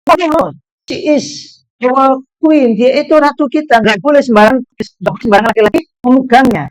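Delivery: earnest English student. Retold or imitated. English girl defending her queen to the student, earnestly. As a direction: insist with mild conviction.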